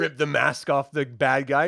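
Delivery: ordinary delivery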